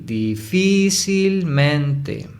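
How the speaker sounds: "difícilmente" very slowly, syllables drawn out and held high; sounding out the stress. Shown as a demonstration. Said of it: "primero identificamos la sílaba tónica". ("difícilmente" muy lento, sílabas alargadas y agudas)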